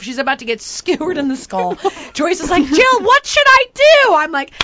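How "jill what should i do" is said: high, loud plea for instructions; helpless Grandma. Retold or imitated. Grandma asking Jill for help in the kayak, flustered.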